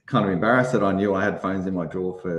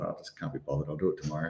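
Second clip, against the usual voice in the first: low, quiet lazy mumble, much softer; lazy inner thought; 5 semitones lower, 9 dB quieter, faster than usual. Retold or imitated. Telling how she put off the charity run, softly muttered. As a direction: mutter it like you can't be bothered. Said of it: "I thought".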